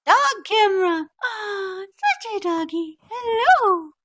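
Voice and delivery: very high, loud squeal at a dog; gushing dog talk. Real, not acted.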